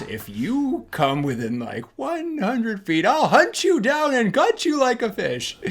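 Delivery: high, loud mock menacing Grinch; Grinch threat. Grinch's outgoing voicemail, hammed-up high threat. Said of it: "he plays it back and goes".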